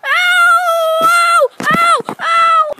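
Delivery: high loud repeated ows; being taped to the wall, yelping; pained yells. Acted. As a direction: cry out in pain each time.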